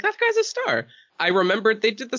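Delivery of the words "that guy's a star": high excited. Retold or imitated. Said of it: "I was like".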